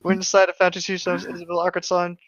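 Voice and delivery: hammed-up storyteller read; dramatic story reading. Reading a fan story aloud to a friend, hammed-up narration. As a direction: read the creepypasta like a mock-serious tale.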